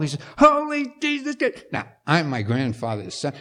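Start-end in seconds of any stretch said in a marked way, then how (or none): 0.4-1.3 high-pitched outburst as a raging director; screaming director. Retold or imitated. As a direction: imitate the director exploding in alarm. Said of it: "who's a screaming, raging man".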